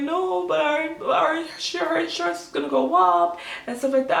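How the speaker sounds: high, hurried pleading; retold whiny protest. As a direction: act out whining at mom. Whining protest to mother, retold.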